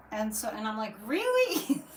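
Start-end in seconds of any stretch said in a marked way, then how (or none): 1.0-1.8 high, loud mock disbelief; incredulous retold reaction. Answering her guide's order to up the conviction, incredulous. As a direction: squeak it up in disbelief.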